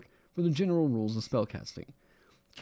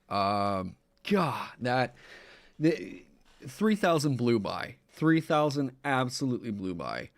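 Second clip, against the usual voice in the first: normal talking voice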